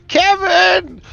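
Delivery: high, drawn-out scream; screaming wife. Acted. Wife screaming after her husband as he is flown off. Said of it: "his wife and daughter are screaming".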